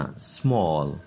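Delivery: small with a round "aw": smawl, not smaal; correct "small". Shown as a demonstration. Teacher corrects students, saying small with a round "aw". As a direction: give the model small with the open "aw". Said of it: "اس کو سمال نہیں پڑھنا".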